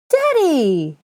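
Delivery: put-on high, loud joyful child scream; scream of delight. A narrator's reading. Little boy spotting his father, a loud happy scream. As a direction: shriek with happiness at seeing him. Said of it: "A scream of delight".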